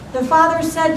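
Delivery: ordinary talk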